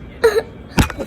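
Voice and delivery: high gasping sob; put-on sob after a wail; fake crying. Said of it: "Why do I hear crying?".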